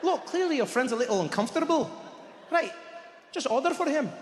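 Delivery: put-on calm the quarrel in a Scottish accent; lilting Scottish-accented peacemaker; Scottish waiter. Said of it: "The waiter was like".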